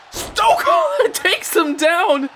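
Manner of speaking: high, stretched-out commentator's cry; excited exclamation. Real, not acted. Commentator calls a sudden takedown, high and excited. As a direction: burst into an excited call, drawing it out.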